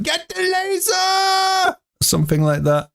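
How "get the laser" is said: mock German shout, much higher and slow; German villain shout. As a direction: bark it like the German henchwoman from Ace Ventura. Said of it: "she says in German".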